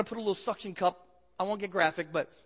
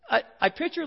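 normal talking voice

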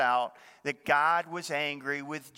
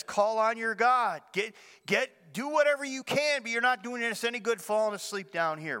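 urgent captain's order; 7 semitones higher than usual. Retold or imitated. Captain orders Jonah to pray.

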